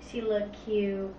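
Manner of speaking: ordinary talk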